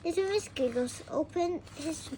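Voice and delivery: ordinary delivery, nothing marked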